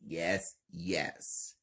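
plain reading voice

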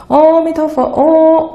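哦 opening 阿弥陀佛 held long and high; wrong-way chant leaning on a long, high 哦; stress on 哦. Shown as a demonstration. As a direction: draw out the first 哦 of 阿弥陀佛 on a high tone. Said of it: "重点摆在哦".

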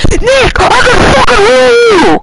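high, loud furious yell; angry shout. Real, not acted. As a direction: scream it at the teammate. Yelling at teammate Nick.

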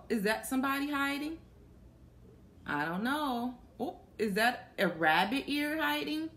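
plain reading voice

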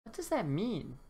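wide-eyed little dinosaur asking; Topsy the dinosaur. Acted.

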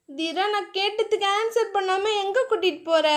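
a hint of a young bride's voice; Diya's voice. A narrator's reading. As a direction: raise the pitch for Diya, curious. Diya's line in story.

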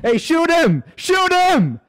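high, loud urgent yells rising each time; yelling shoot him. Real, not acted. Gamer yelling at teammate to shoot the gunman, loud urgent yell. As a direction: scream at them to fire now.

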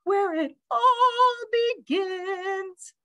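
high, slow, reverent sing-song; marveling bird. A narrator's reading. Bird marvels where reading begins, high and slow. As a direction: stretch it high with wonder. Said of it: "she marveled".

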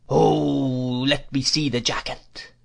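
put-on old man's drawn-out whine; crazed old dealer's whining cry. A narrator's reading. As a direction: stretch the "Oh" into a singsong wail. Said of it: "cried the man".

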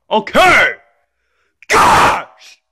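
exasperated shout into a scream. Acted. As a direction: give in with a tantrum scream. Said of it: "Stop screaming".

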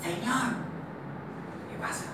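soft, hushed hawking; quiet voices hiding in a closet; furtive closet exchange. Acted. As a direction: pitch a sale without being overheard. Boy and man hiding in closet.

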